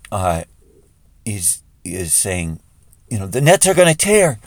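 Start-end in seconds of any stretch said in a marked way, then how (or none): 3.3-4.4 high, loud cry of alarm; excited alarm. Acted. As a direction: call it out excitedly, pitched well up. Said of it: "gets all excited".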